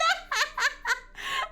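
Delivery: high shrieking laughter. Real, not acted. Hearing about the church aunt's slip, shrieking laugh.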